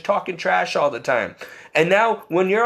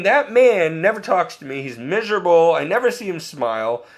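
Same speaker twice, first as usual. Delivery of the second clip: normal talking voice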